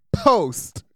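loud, high, triumphant call; drunk declaration. Retold or imitated. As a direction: announce it with drunk bravado. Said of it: "I went".

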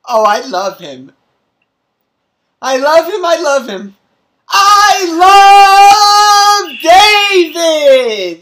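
loud, high declaration of love; shouting a confession outdoors. Pretend. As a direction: yell it for the whole world to hear. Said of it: "They'll put you out of the building".

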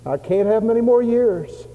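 high and loud, pressing; urgent plea. Retold or imitated. Old missionary urges the church, loudly pleading. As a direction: plead against time. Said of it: "he said".

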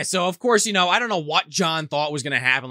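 usual speaking voice

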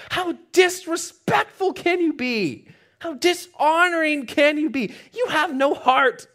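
outraged, high-pitched indignant rant; furious. Acted. David hearing the story of the stolen sheep, furious. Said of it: "he was getting furious".

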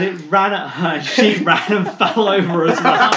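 words breaking into laughter; laughing while talking. Real, not acted. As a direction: giggle through the story. Laughing at friend's fall.

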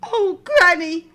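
put-on amazed little girl. A narrator's reading.

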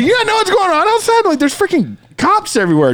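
high-pitched, disbelieving retelling; retold incredulity. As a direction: replay your alarm at a clueless friend, voice way up. Retells disbelief at oblivious friend.

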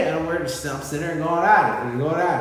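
ordinary talk

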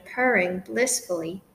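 ordinary delivery, nothing marked

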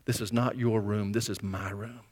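mock anger held low and quiet; understated, tight-reined annoyance in a low voice; retold anger, softly and firmly laid down; angry. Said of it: "he gets very angry".

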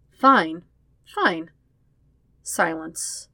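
ordinary delivery

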